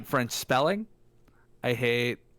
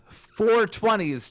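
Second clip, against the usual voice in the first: "four twenties" slow, higher and louder; stress on four twenties; 7 semitones higher, 4 dB louder, much slower than usual. Shown as a demonstration. Player explaining French eighty to John, slow stressed. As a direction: spell out "four twenties" slowly with weight. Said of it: "explaining French numbers".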